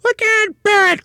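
shrieky high cry, very loud; Missus Twit. A narrator's reading. Wife demanding an explanation from her husband, high loud cry. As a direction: cry it out at the top of your range. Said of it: "cried Missus Twit".

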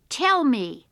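loud, high command; boy's insistent shout; angry demand. A narrator's reading. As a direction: demand it with a stamp. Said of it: "The boy stamped his foot".

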